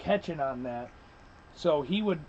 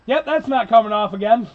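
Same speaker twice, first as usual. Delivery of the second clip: louder, higher, a rueful punchline; loud rueful groan; 5 semitones higher, 9 dB louder than usual. Real, not acted. Telling friends his tube is stuck, rueful loud blurt. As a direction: blurt it out, half laughing at yourself.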